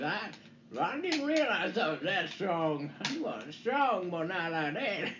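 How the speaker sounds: hammed-up big-guy voice, slow and drawn out; mock strongman boast, words stretched slowly; Mister Yacht. Joking as the man who broke his wheel, slow put-on voice. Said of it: "He said".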